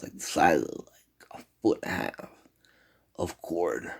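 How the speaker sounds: ordinary talk, nothing marked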